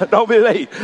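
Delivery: real chuckles around the words; laughing. Real, not acted. Preacher jokes about coming back next week, laughing. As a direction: laugh through a teasing reminder.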